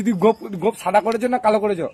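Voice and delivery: ordinary talk, nothing marked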